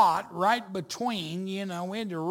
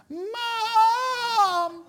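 very high, loud, long mock yell; child yelling; 7 dB louder than usual. Youngest son dangling from a root, yelling for help. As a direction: holler desperately for your mother. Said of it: "and he's yelling".

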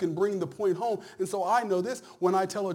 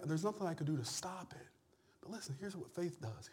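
ordinary talk, nothing marked

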